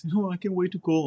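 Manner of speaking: lightly mocking a recruit who can't wait to call home; mock eager recruit. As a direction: ham up a recruit's excitement about the phone call.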